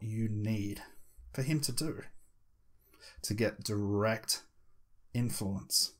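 slow hypnotic phrasing broken by long pauses; measured, lulling hypnotist's delivery. Shown as a demonstration. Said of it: "you talk a little bit slower".

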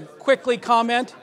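"quickly" given deliberate emphasis; stress on quickly. Real, not acted. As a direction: lean on the word "quickly". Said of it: "I'm emphasizing the word quickly".

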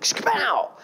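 high, sharp comic punch noise; mock fight sound; punch sound effect. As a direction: burst out with a cartoon punch sound. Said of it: "I'm like".

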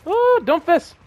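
high, loud thrilled squeal; excited. Real, not acted. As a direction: cheer at the loot. Picking up the Doomfist item.

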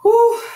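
a sharp, high exhale of relief, loud; exasperated high whew. Real, not acted. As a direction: blow it out high and loud like you're worn out. Struggling with tangled hair.

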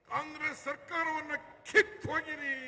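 high mock quote of the rival, pushed out; mocking Deve Gowda. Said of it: "mister Deve Gowda ಹೇಳಿದ್".

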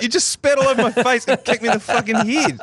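high, whiny mock outrage; indignant protest. Child's protest in a retold story.

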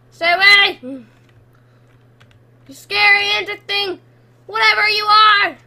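loud, high yell at a monster; scared, defiant yelling; frightened warning. Real, not acted.

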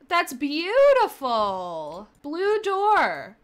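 loud, drawn-out delight; delighted gushing. Real, not acted. Player admires the new blue door, delighted gushing. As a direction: gush over it, stretching the words out slowly.

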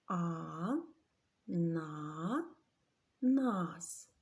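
А, на, нас split into separate syllables with pauses. Shown as a demonstration. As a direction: tap out А, на, нас one syllable at a time. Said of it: "Скільки разів торкнеться наше підборіддя". (вчителька вимовляє ананас по складах)

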